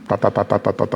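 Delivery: rapid-fire "ta-ta-ta" typing noise; imitated teleprinter clatter. Veteran reporter recalling teleprinter typing, imitated machine clatter. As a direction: mimic the clacking of a teleprinter.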